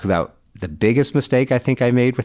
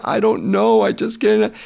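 high-pitched mock crying child; retold tearful wail, much higher; imitated sobbing; 13 semitones higher than usual. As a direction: imitate a daughter breaking down. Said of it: "she just broke down crying and sobbing".

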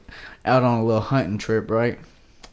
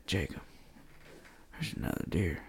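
much softer, hushed retelling; 5 semitones lower, 15 dB quieter than usual. Retold or imitated. Hunter in the blind alerting his friend, hushed. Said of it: "Bro, stop whispering".

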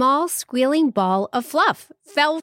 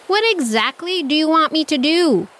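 angry shout; 8 semitones higher than usual. Acted. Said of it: "he shouted".

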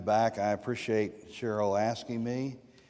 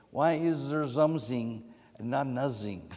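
imitating a German accent, mild th as z; German accent impression. Imitating Tillich to the class in a German accent. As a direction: quote Tillich in broken English with a German accent. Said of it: "In his broken English and German".

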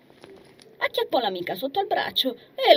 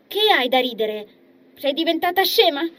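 teasing; 7 semitones higher, 6 dB louder than usual. Acted. Nitz needling laughing friend.

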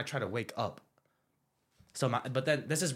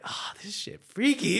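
freaked-out quote; 10 semitones higher, 5 dB louder, slower than usual. Retold or imitated. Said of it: "And I was like".

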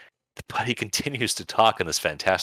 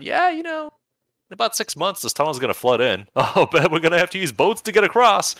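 much higher, squeaky put-on voice; mock character voice; 12 semitones higher, 4 dB louder than usual. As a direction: play a silly high-voiced survivor, laughing mid-line. Quoting game characters' talk.

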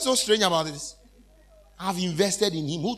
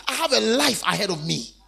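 ordinary talk, nothing marked

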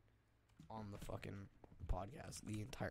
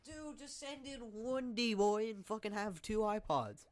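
mock dumb voice; 10 semitones higher, 4 dB louder than usual. Mocking suggestion, put-on voice.

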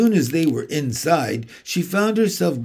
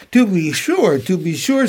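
shy fawn voice; 4 semitones higher, 3 dB louder than usual. Acted. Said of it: "said the fawn".

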